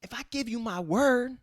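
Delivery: a hint of a high put-on thug voice; lightly voiced tough guy. Imitating the thug promising the kid, lightly put-on voice. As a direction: sell the promise, mock-sincere.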